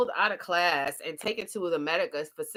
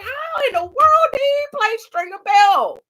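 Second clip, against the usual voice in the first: a loud, much higher cry of disbelief; astonished; 14 semitones higher, 11 dB louder than usual. Retold or imitated. Reviewer first hearing Idris Elba talk, loud high disbelief. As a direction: shout out your amazement. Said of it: "I was just like".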